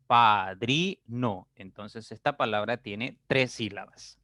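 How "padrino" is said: "padrino" said very slowly, syllable by syllable. Shown as a demonstration. Teacher showing the class how to split the word. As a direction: stretch "padrino" into its three syllables. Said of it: "y diré".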